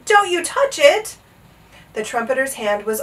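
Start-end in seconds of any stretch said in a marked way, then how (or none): 0.0-1.2 high, slow, loud warning; shouted warning. A narrator's reading. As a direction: shout it word by word. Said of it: "shouted Bartholomew".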